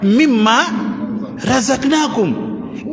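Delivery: slow, drawn-out Arabic recitation of the verse; Quranic recitation. Retold or imitated. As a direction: intone the verse slowly, like reciting in prayer. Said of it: "Allah says".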